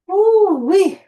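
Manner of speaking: high, loud "woo-wee" to celebrate the set; excited whoop. Real, not acted. Coach celebrating the end of a set, loud excited whoop. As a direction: cheer like you just finished a hard set.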